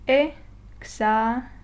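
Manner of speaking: exámenes slowly broken into separate syllables; exámenes, one syllable at a time. Shown as a demonstration. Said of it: "divide this word into syllables".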